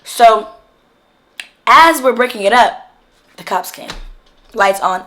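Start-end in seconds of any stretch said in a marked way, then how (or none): none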